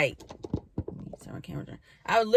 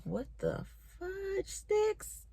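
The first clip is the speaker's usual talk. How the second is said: much higher, soft and slow, like a stunned cartoon squeak; squeaky disbelief; 12 semitones higher, 12 dB quieter, much slower than usual. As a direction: stretch it out high and small in amazement. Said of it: "So I'm just like".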